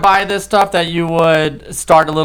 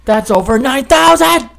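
much higher anime villain shout; Dragon Ball Z villain; 9 semitones higher than usual. Retold or imitated. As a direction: belt it like an anime power-level scream. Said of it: "how good that impression was".

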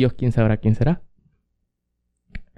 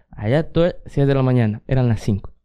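normal talking voice

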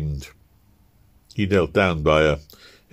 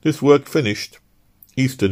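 ordinary delivery

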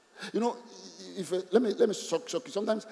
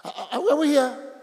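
calling out; 9 semitones higher, 6 dB louder than usual. Real, not acted. Preacher calls out to congregation.

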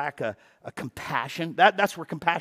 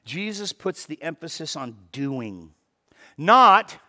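ordinary delivery

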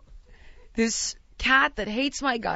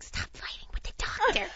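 mom's hushed, urgent telling-off; imitated whispered scolding; 10 semitones higher, 6 dB quieter than usual. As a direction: whisper a reprimand so the doctor won't hear. Mom discreetly scolds her at doctor's.

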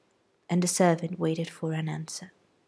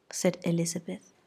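ordinary delivery, nothing marked

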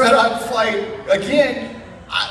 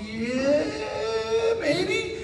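doubtful; 4 dB quieter than usual. Retold or imitated. Dodging a $500 ticket change.

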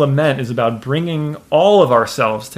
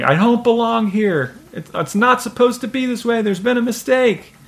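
retold shouting; 10 semitones higher than usual. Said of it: "and then he starts shouting".

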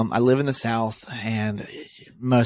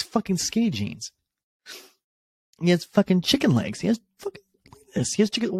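incredulous high voice; 9 semitones higher, faster than usual. Real, not acted. Mocking a fighter's legs.

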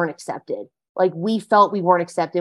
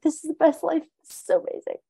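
soft, voiceless gasp of delight; breathless whisper of wonder; 7 semitones higher, 5 dB quieter, faster than usual. Acted. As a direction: whisper it, as if awed. Waking up thrilled with life.

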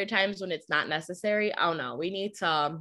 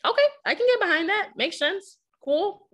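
chipper agreement; 13 semitones higher, 3 dB louder than usual. Retold or imitated. Acts out agreeing at staff meeting.

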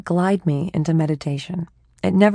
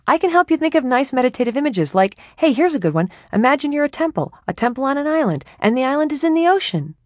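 higher, louder, over-helpful inner voice; eager chatty mind; 9 semitones higher, 3 dB louder than usual. Acted. Her restless mind pitching meditation images, eager and chatty. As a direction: sell the idea like a keen helper.

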